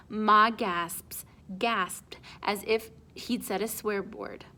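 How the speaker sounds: plain reading voice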